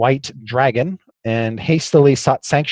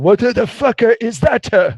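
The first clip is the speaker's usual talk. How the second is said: dwarf voice; 9 semitones higher, 4 dB louder than usual. Retold or imitated. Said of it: "Zog was saying".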